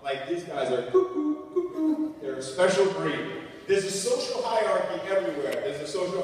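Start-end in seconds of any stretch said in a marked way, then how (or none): 0.9-2.1 high sing-song bird call; cuckoo call. Acted. Speaker mocking the crazy iron workers, high cuckoo call. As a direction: hoot it like a cuckoo clock.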